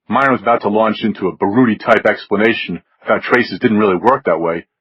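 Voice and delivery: usual speaking voice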